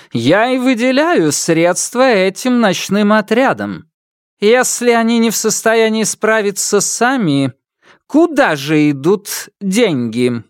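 put-on high, imperious old empress; haughty imperial reproach. A narrator's reading. Empress voiced by narrator.

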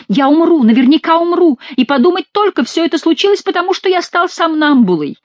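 very high, wailing lament; panicked babble. A narrator's reading. Terrified monk is sure he will die, panicked babble. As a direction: lament in rising panic, pitch held high. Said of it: "лепетал Гранфло".

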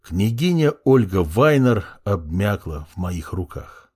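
usual speaking voice

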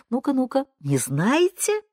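high, coaxing quiz-master lilt; mock-gentle condescension. A narrator's reading. Yasha quizzing the client at the computer, sweetly condescending. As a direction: draw out the question with sweet contempt. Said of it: "ласково доказывая ничтожность экзаменуемой".

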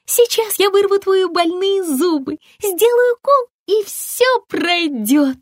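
high, sing-song fairy voice; a cheerful fairy promising to pull teeth; tooth fairy. A narrator's reading. (высокий голос зубной феи)

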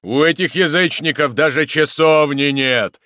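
loud, heavy bass roar; chorister's bass bark. A narrator's reading. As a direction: bellow it like a church bass. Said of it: "рявкнул бас певчего".

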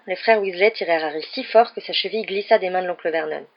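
ordinary delivery

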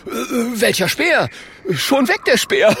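high, flustered voice with nervous giggle; nervous innocence. Acted. Klonk drops his spear before the bear, nervous.

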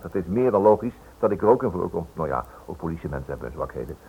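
ordinary talk

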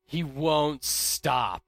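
ordinary talk

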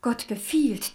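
ordinary delivery

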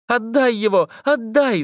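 put-on high desperate girl's yell; shouting. A narrator's reading. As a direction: scream at the fountain to give him back. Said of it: "закричала она". (отчаянный крик)

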